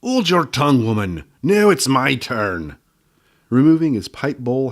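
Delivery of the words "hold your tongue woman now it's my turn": loud, higher gnome shout, slowing at the end; old gnome shouting down his wife. Acted. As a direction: cut her off and claim the floor. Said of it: "he shouted".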